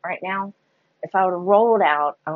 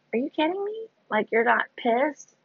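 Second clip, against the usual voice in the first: retold disbelief; 8 semitones higher, 3 dB quieter than usual. Shocked at the kind offer.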